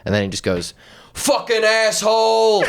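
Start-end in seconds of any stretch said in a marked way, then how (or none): 1.1-2.6 high, drawn-out New York yell; hammed-up Italian New York outburst; New York accent. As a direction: curse like an Italian New Yorker, pitched up. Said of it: "Just an Italian New York accent".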